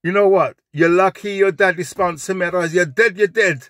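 high, loud patois threat; angry Jamaican accent. Retold or imitated. The flatmate threatening him the next day, loud high patois. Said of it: "he goes".

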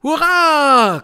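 high, loud hurrah; screeched cheer. A narrator's reading. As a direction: shriek it in triumph. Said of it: "kreischten die Hexen". (kreischender Jubel, hoch und laut)

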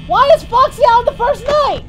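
high loud panicked yelling. Real, not acted. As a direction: freak out at the monster. Panicked at Foxy appearing.

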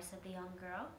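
ordinary delivery, nothing marked

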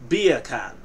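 bacon said "Beer can"; "Beer can" as Jamaican "bacon"; Jamaican accent. Shown as a demonstration. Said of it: "with a British accent".

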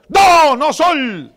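stretched, high, loud yell; shout. Retold or imitated. Pastor yelling at worship musicians over a wrong chord, loud slow shout. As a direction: bellow the correction across the rehearsal. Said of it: "yo grito".

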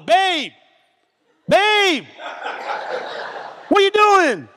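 wife cutting through his rage; wife's alarmed call. Retold or imitated. Wife calling him off fight.

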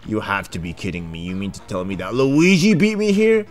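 ordinary talk, nothing marked

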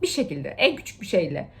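ordinary talk

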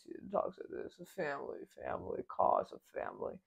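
mock-serious tough-guy voice; Dom impression. Mocking the serious hero's speech, hushed husky impression.

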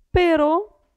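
ordinary delivery

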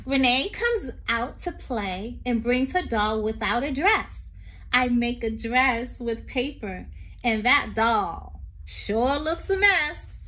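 sing-song read-aloud poem voice. Acted. Teacher reading a poem to children, lilting.

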